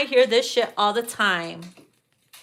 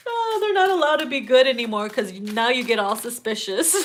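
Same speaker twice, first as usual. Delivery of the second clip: normal talking voice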